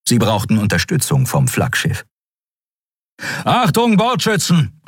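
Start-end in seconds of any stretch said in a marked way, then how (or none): 3.4-4.8 calling out. A narrator's reading. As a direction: shout across the bridge to the gunners. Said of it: "rief er".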